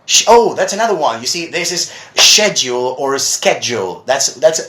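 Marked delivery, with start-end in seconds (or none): 2.1-4.0 "schedule" first starting "shed", then "schedule" starting "sked"; British versus American. Shown as a demonstration. Teacher comparing American and British English, contrasted demo. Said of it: "big difference between American and British".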